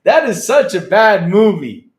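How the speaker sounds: slow, loud and high, hammed-up disgust; loud, slow mock-outraged exclamation; loud groan of disbelief. Real, not acted. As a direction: drag it out loudly in mock horror.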